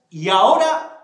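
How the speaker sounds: fuerte y lenta, con risa; orden burlona. Acted. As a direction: lo humilla a gritos entre risas. Asaltante humilla a Xico. (el asaltante ordena a Xico, fuerte y riendo)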